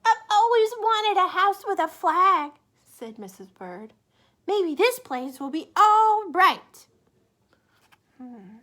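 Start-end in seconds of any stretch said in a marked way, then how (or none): 0.1-2.6 high, loud eager voice; Missus Bird delighted. Acted. Missus Bird excited about the flag house, high and loud. 4.5-6.9 high, loud eager voice; Missus Bird delighted. Acted. Missus Bird excited about the flag house, high and loud.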